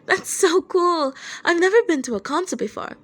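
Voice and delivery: put-on young drummer gushing about concerts, high and excited. A narrator's reading. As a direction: gush like an eager kid. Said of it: "Kenji said excitedly".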